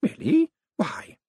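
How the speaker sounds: put-on high old lady voice; old lady, arch. A narrator's reading. Old lady questioning Moist, high and arch. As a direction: ask archly.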